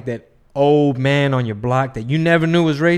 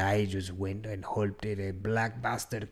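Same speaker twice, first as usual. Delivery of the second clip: imitating his confession; 4 semitones lower, 8 dB quieter than usual. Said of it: "She said".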